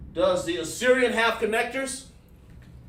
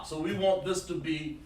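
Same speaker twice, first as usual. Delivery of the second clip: ordinary talk, nothing marked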